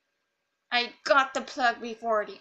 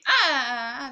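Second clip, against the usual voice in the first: ordinary talk, nothing marked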